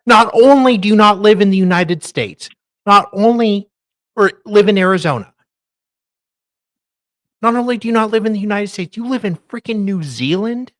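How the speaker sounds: loud, high, rapid disbelief; incredulous rant. Real, not acted. Cohost ranting at a New Zealand viewer, loud disbelief. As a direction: hammer each not only.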